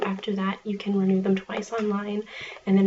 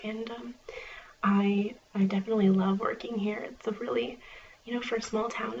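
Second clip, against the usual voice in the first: normal talking voice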